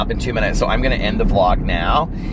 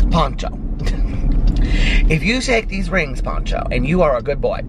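imitating the woman; 6 semitones higher than usual. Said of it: "I just need cute stuff like this".

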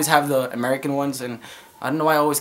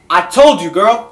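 higher, louder playful bravado; mock tough talk; 3 semitones higher, 7 dB louder, slower than usual. Mom playing tough with her baby, high and loud.

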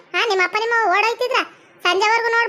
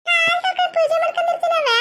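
much higher, jeering mimicry; mocking echo; 7 semitones higher than usual. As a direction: throw the plea back in a high mock voice. Mockingly echoes the daughter's pleading promise. (ಅಣಕಿಸುತ್ತಾ ಎತ್ತರದ ದನಿಯಲ್ಲಿ)

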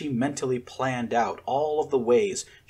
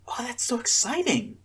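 much higher, eager exclamation; excited declaration; 11 semitones higher than usual. A narrator's reading. Inez hearing about the generator, excited. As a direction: gush about it. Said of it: "she declared".

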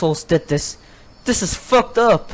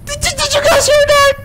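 high, loud stammering panic; panicked stammer; 4 dB louder than usual. Real, not acted. Player hearing something nearby, panicked stammer. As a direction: stutter it out in fright.

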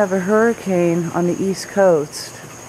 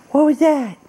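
mock surprise; 8 semitones higher than usual. Real, not acted. Gasping at the cat.

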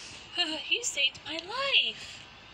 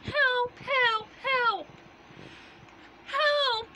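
high, loud repeated cries; high loud calls for help; calling for help; 8 semitones higher, 4 dB louder, much slower than usual. Acted. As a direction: keep shouting for rescue. Captive girl calling for rescue.

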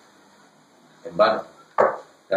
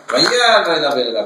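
loud vaya; 3 semitones higher, 6 dB louder than usual. Shown as a demonstration. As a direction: belt out the first vaya, then let it settle. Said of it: "Vaya es en voz alta". (vaya dicho en voz alta)

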